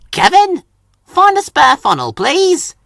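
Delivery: tooting call. A narrator's reading. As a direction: toot the order across the yard. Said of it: "he tooted".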